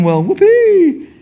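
slow, high, sarcastic whoopee; mock the careless sinner's glee; sarcastic high-pitched cheer. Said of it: "some see that as a green light".

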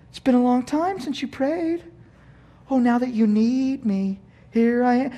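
high-pitched mock reproach; taunting inner voice. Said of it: "there's that voice again".

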